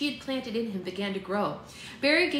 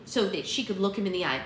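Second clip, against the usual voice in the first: usual speaking voice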